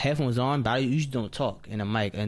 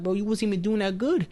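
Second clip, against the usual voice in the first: mocking teammate; 9 semitones higher than usual. Mocking a teammate mid-game.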